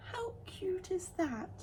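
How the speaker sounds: high, slow whisper. Real, not acted. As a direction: breathe it out adoringly. Gushing over bumblebee.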